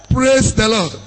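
normal talking voice

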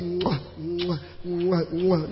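soft, quiet smacking chew sounds; chewing gum. Retold or imitated. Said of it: "you cannot enter with chewing gum in your mouth".

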